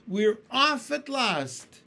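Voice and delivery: slow, loud, high triumphant cry; shouting. A narrator's reading. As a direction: bellow it out at last. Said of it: "someone was shouting".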